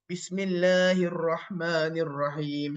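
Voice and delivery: low, slow, drawn-out Quran recitation; measured low chant with long vowels. Shown as a demonstration. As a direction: recite it slowly, breathing calmly. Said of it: "you read slowly".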